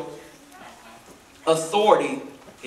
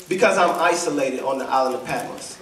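very low, subdued voice of John; understated grief, sunk deep in pitch; weeping John's answer; 7 semitones lower than usual. Acted. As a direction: answer low and heavy, without sobbing. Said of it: "he's sobbing uncontrollably".

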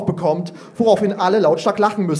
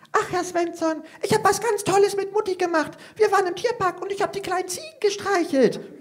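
put-on much higher, tiny proud child's voice; proud squeaky little girl; 13 semitones higher, 5 dB quieter than usual. Youngest girl proudly tells the teacher, squeaky and high. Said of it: "piepst Ida stolz".

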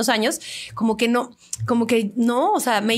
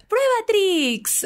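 much higher and very slow, drawn-out slogan; kids' cereal ad tagline; 11 semitones higher, much slower than usual. Shown as a demonstration. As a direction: land the brand name like the end of a kids' ad. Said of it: "¿Cómo dice, por ejemplo, el comercial?". (eslogan de comercial infantil, agudo y muy lento)

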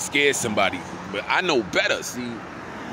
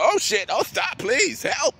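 high, squealing fake panic; mocking a terrified driver, much higher and louder; mock frightened plea; 12 semitones higher, 4 dB louder, slower than usual. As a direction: ham up a scared woman begging. Mocking woman who drove up.

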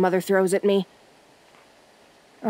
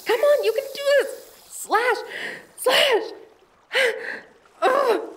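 much higher, frantic, breathless; panicked struggle; 9 semitones higher than usual. Acted. Explorer hacking free of strangling vines, panicked. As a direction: fight for your life against grabbing vines.

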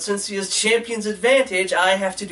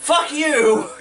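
mock angry; 6 semitones higher than usual. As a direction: snap back, half joking. Playful retort to the co-host.

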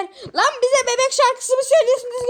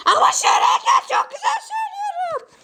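a piercing high yell of a little singer; loud high greeting; 11 semitones higher than usual. Acted. Pumpkin yells his greeting into the judge's ear, loud and high. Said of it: "Ne bağırıyorsun?".

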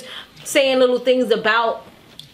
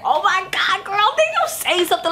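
high-pitched scandalized reaction; mock shock; 8 semitones higher than usual. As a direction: imitate a shocked girlfriend, pitched up. Imitates shocked reaction to remark.

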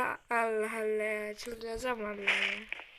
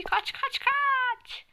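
high, rising panic; panicked urging; 12 semitones higher, much slower than usual. Real, not acted. Gamer urging his character to flee, panicked. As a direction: yell run as it goes wrong.

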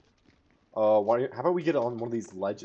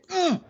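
excited squeal; 9 dB louder than usual. Real, not acted. Excited squeal at something on screen.

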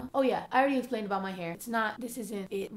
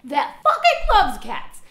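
mock outrage; 6 semitones higher, 11 dB louder than usual. Real, not acted. Teasing cat-loving internet.

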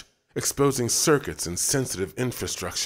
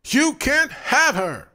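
high, loud, slow scream; 7 dB louder, slower than usual. A narrator's reading. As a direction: scream it possessively. David swings the lamp.

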